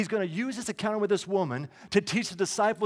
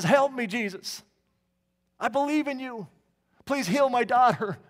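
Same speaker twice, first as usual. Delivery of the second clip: higher, louder begging cry; desperate plea; 5 semitones higher, 4 dB louder than usual. Acted. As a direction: plead like a mother at the end of her rope. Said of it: "she's crying out".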